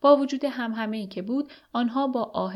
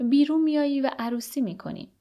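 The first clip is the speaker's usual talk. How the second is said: raised, higher-pitched call; narrated shout; 6 semitones higher, 4 dB louder than usual. A narrator's reading. Marie shouts to the prisoner about getting out and marrying, mildly raised call. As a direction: call it out with a mild storyteller's shout. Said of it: "دوباره فریاد کشید".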